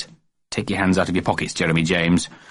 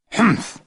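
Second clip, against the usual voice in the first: put-on child humph; 13 semitones higher than usual. A narrator's reading.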